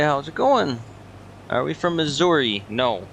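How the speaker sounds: ordinary delivery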